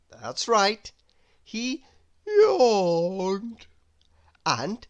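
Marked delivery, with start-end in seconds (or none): 2.3-3.7 a loud yawned word; sleepy yawn. A narrator's reading. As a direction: yawn right through it. Narrator acts out a yawn.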